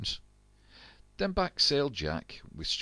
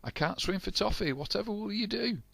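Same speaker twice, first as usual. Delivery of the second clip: much higher frantic voice; high panic. A narrator's reading. Stew in the water, squeaking in panic.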